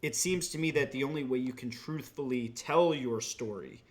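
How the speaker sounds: ordinary talk, nothing marked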